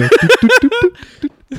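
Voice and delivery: high squeaky Looney Tunes sound; cartoon noise. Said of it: "il fait".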